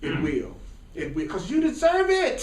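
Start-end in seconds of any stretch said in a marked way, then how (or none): 1.3-2.4 high, rousing insistence; emphatic affirmation. Real, not acted. Preacher tells the room they deserve it, emphatic affirmation. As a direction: pitch it way up to rally them.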